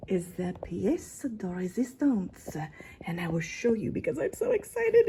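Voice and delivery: hammed-up French accent; horrible French accent. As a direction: slip into a bad French accent out of excitement. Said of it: "horrible French accent".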